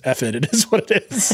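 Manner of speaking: shrugging, dismissive contestant voice; reality show contestant. Retold or imitated. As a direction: toss it off like a jaded contestant. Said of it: "Top Chef making everyone just be like".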